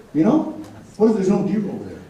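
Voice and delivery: normal talking voice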